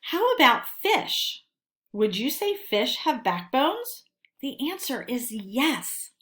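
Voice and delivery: lively storybook reading to a class; animated reading to children; read-aloud story narration. Acted. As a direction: read it like a friendly animal presenter talking to kids.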